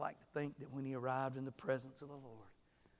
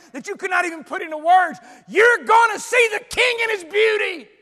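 building from raised voice to loud, high shouting; preaching at full force; 12 semitones higher, 19 dB louder than usual. Acted. Preacher to congregation about heaven's beauty, building shout. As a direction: preach it louder and higher with every line.

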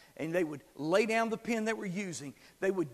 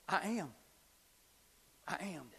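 hushed reverence; 4 semitones lower, 10 dB quieter, much faster than usual. Real, not acted. Reverent naming of God.

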